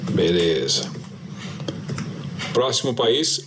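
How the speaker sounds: ordinary talk, nothing marked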